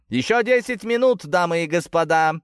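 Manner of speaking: high, loud arena announcer call; booming herald. A narrator's reading. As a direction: boom it across the whole arena. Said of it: "разнёсся зычный голос Герольда". (зычный голос глашатая)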